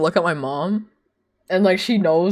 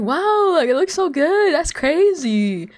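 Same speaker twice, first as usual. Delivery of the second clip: higher, lightly voiced grandma gushing; impressed grandma; 11 semitones higher, slower than usual. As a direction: play grandma marvelling at graphics. Grandma admiring the game.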